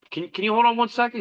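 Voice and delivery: high and fast, barely containing the thrill; urgent excitement. Retold or imitated. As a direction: blurt it out quickly, way up high. Urgent request mid-jam.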